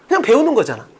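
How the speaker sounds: ordinary talk, nothing marked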